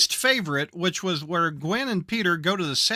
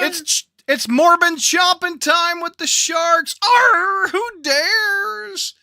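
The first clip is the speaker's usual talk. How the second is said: high, loud hammed-up villain snarl; hammy Morbius voice; 10 semitones higher, 5 dB louder, slower than usual. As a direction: roar at the shark like a pulp villain. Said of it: "He's talking to a shark".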